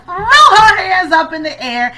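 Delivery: loud, high, triumphant burst; excited storytelling yell; exultant shout. Real, not acted. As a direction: belt it out as if celebrating a win. Retelling the victorious arm throw.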